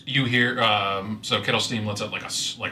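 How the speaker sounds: usual speaking voice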